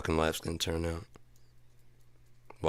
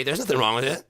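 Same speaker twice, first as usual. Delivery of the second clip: ordinary talk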